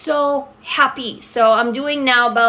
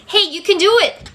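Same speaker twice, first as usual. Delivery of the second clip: higher-pitched pep talk to a viewer; encouraging; 7 semitones higher than usual. Real, not acted. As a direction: cheer them on, pitched up. Cheering on a viewer.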